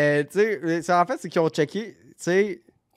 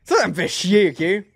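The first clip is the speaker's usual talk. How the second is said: loud, raised-pitch outburst; worked-up venting; 5 semitones higher, 7 dB louder than usual. Real, not acted. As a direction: vent indignantly at being snubbed. Host venting about being snubbed.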